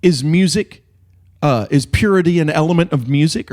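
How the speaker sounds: quizzing a student caught talking; stern teacher. Retold or imitated. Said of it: "He said".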